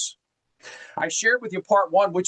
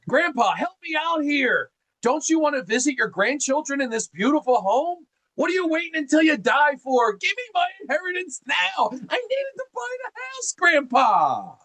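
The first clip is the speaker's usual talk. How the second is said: high, rising whiny plea to grandpa, climbing higher as it goes; begging grandchild; 10 semitones higher than usual. Acted. Grandchild begging grandpa for inheritance, high whiny plea. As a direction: wheedle grandpa like a spoiled grandkid, pitch climbing. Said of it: "That's Italian style right there".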